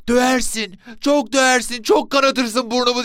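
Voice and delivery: put-on very high, loud child's voice; loud, high, wary son answering his father; boy calling from inside. A narrator's reading. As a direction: call back in a high-pitched boy's protest. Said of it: "diye seslendi içeriden".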